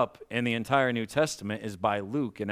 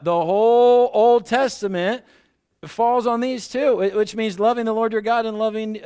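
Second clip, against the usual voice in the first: much higher and louder, slowing on the big words; preaching at full force; 10 semitones higher, 6 dB louder than usual. Real, not acted. Pastor rousing the congregation about the two commandments, preaching at full force. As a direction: lift the room, preach it out.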